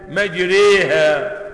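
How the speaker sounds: مجريها drawn out slowly, the middle vowel leaning toward e; imala reading. Shown as a demonstration. Teacher showing students how Hafs reads the word. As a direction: stretch مجريها, tilt the a to e. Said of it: "هو بيقرأها إزاي".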